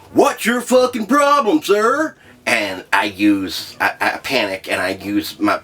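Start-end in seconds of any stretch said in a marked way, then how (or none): 0.1-2.2 much higher, louder mock cop snapping; a cartoonish furious cop; angry cop. Officer leaning in at the car window, high mock anger. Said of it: "and he goes".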